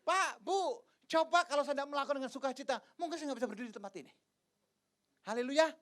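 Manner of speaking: loud, high, fast fervent sermon; preaching at full force. Acted. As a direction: drive the point home to the congregation. (berkhotbah dengan berapi-api)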